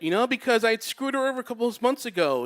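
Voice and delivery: ordinary delivery, nothing marked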